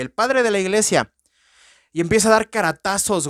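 ordinary talk

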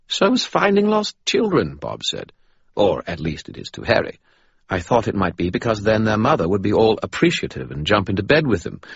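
Bob voice. Acted. Bob talks.

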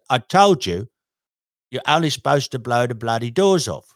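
Michael Caine. Acted. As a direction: punch out the famous line in Cockney. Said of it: "who could do a Michael Caine".

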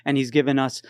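ordinary delivery

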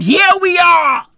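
high-pitched I-told-you-so cry; high, emphatic climax to a rant; triumphant outburst. Real, not acted. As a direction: crow it out, voice leaping up.

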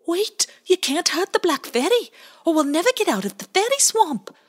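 high, loud urgent call; high loud quick plea; Bowie crying out. A narrator's reading. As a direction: stop your friend in a panic. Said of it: "cried Bowie".

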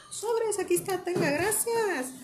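high, slow, overly sweet; naive sweet voice. Retold or imitated. Naive buyer paying the vendor, sugary high voice.

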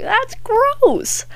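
high squealed disgust. Retold or imitated. To the laughing dad, high squealed disgust. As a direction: recoil at the thought, high. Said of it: "And I was like".

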